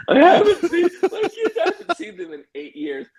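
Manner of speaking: very high, hammed-up sobbing wail; mock crying. Dad retells pleading about his kids, tearful high wail. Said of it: "my kids can hear me crying".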